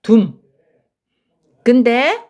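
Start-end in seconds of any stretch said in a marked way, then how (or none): 0.0-0.4 둠 cut off short and sharp; staccato. Shown as a demonstration. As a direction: clip 둠 short. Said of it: "스타카토로 끊을 거예요".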